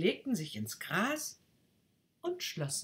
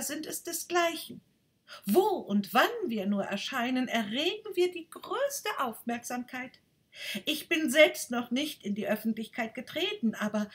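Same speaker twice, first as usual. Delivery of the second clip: higher, grand self-important voice; boastful rocket; 10 semitones higher, 4 dB louder than usual. Acted. Rocket brags to the duck about its family, higher and grand. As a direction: brag like royalty.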